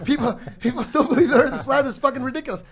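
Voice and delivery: high, loud, laughing disbelief; incredulous outburst. Retold or imitated. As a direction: pitch it way up, scandalised and amused. Retells his disbelief, laughing.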